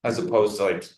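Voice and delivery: usual speaking voice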